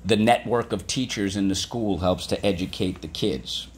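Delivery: ordinary talk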